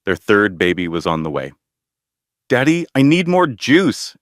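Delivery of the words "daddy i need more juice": put-on a small girl insisting on juice; demanding little girl. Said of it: "Emma demanded".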